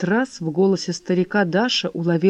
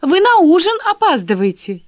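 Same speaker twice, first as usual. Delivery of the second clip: high, loud, clear call; ringing call; 10 semitones higher, 6 dB louder than usual. Acted. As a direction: call out brightly across the garden. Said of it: "говорила звонко". (звонко)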